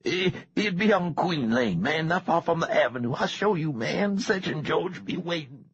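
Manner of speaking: high, quick, frightened stammer; a frightened boy whimpering an answer. A narrator's reading. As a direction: blurt it out scared of being hurt. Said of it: "The heavier boy whimpered".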